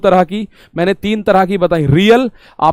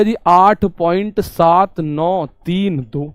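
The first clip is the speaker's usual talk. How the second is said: slow student reading; much slower than usual. Retold or imitated. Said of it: "जैसे आपने बोल दिया".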